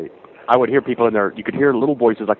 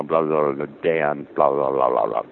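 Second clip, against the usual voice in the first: low mumbled blah-blah imitation; mock low man's voice; imitating partner's dad; 5 semitones lower, slower than usual. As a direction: drop lower and lower, mimicking a man's overheard chatter. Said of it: "it was his voice".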